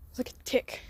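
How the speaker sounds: whispering. Real, not acted. Hearing noise inside truck.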